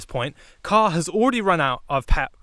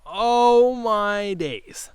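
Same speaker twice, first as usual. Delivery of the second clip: very drawn-out, higher-pitched mock despair; exasperated dismay; 5 semitones higher, much slower than usual. Driver thinking after the window tint news, drawn-out dismay. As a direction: stretch it out in theatrical disbelief. Acted dismay at second violation.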